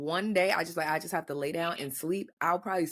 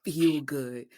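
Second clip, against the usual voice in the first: whiny sick man; 4 semitones lower than usual. Retold or imitated. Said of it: "and like".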